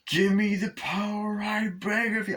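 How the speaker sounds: high, slow, drawn-out chant voice; epic incantation. Retold or imitated. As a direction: intone it grandly, stretching every word. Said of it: "he says it really epically".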